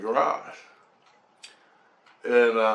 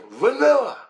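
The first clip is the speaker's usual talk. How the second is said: high, loud re-enacted reply; mock-exasperated kid's call; emphatic answer; 13 semitones higher, 7 dB louder than usual. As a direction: blurt the flavour out, pitch leaping. Kid calls out a flavour.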